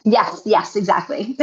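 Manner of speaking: ordinary talk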